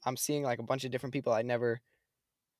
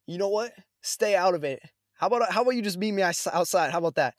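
retold challenge, higher and louder; confrontational; 5 semitones higher, 4 dB louder than usual. Customer turns on the man behind him, confrontational. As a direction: square up to him, voice raised.

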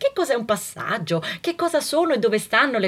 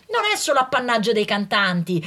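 normal talking voice

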